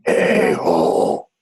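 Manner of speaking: low, drawn-out spirit voice; imitated spirit scream. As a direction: drag it out slowly, way down low. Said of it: "Screams on his recorder".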